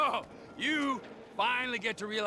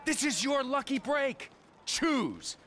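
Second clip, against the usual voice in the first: raised-pitch emphatic push; urgent insistence; 9 semitones higher, slower than usual. Acted. Boss urging a wavering man to take his chance, insistent. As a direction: press it on them, pitched high.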